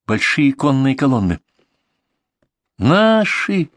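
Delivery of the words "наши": high, excited cry of relief; exhaled exclamation. A narrator's reading. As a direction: exclaim it high, overjoyed at rescue. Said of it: "выдохнул Клаус". (радостный возглас на высокой ноте)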